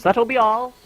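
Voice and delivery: high, loud lady-of-the-house voice; high-pitched, projected dismissal; dismissing a servant. Acted. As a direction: send the servant away in a high call. Merchant dismisses her spectral servant.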